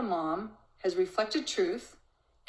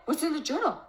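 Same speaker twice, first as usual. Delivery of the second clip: higher, alarmed mock-panic question; panicked mom; 9 semitones higher, faster than usual. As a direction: play the mom losing her footing. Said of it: "Instead of the mom going into distortion".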